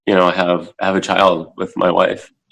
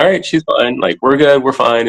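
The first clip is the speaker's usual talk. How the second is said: higher reassuring tone; self reassurance; 7 semitones higher than usual. Retold or imitated. Dad reassures himself his daughter is fine, high and reassuring. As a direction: talk yourself down, raised and reassuring. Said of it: "I'm just like".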